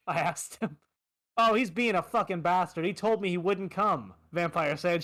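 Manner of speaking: ordinary talk, nothing marked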